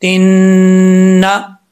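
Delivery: loud slow recitation; nasal ghunna. Shown as a demonstration. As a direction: stretch the nn of तिन्ना. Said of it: "एक अलिफ की मिकदार गुन्ना करेंगे।".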